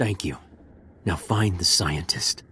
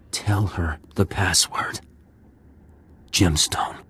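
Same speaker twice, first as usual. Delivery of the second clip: whisper; 3 semitones lower, slower than usual. Acted. Wounded man passing secret.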